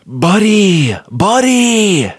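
put-on high, excited man-child voice; Elf's Buddy. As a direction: call out like a goofy elf. Elf Buddy voice.

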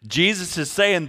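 normal talking voice

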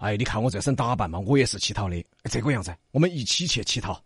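low, soft beggar voice; coaxing young beggar. A narrator's reading. Young beggar greets little girl Xiu'e, low and coaxing. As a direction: approach her softly and low.

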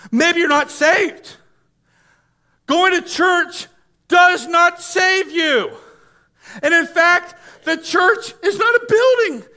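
high, loud, forceful pulpit shout; shouted preaching. Real, not acted. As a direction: thunder the correction at the congregation.

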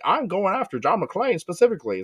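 a hint of a movie villain's threat; mock villain. Acting out the villain Simon's threat.

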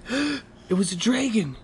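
high, wondering exclamation; excited. A narrator's reading. Reader reveals dragon to child, high excited. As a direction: gasp it out in wonder.

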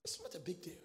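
whispered aside. Real, not acted. Preacher whispering an aside to himself. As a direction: drop to a hush under the breath.

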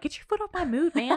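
high-pitched sassy telling-off. Pretend. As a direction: play it sassy, pitched well up. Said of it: "being sassy".

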